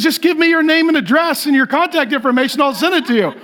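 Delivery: high-pitched imitation, pressing the point; persistent shopper. Lady presses stranger for his details, high mock insistence. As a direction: play a woman who won't take no.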